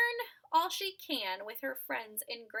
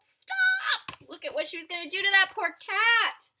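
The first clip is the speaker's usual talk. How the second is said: high, loud "Stop!" then fast scolding; parents yelling; 7 semitones higher, 11 dB louder than usual. Acted. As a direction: yell at her to stop. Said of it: "but her parents yelled".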